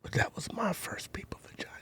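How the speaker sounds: hushed whisper. Real, not acted. Confiding to his friend, hushed whisper. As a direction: whisper it like a secret.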